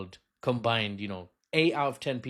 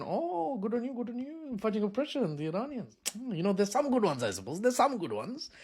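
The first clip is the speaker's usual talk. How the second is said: high mock approval; sarcastic; 8 semitones higher than usual. Comedian mocks Western picks, high sarcastic approval. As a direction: praise them with a sneer.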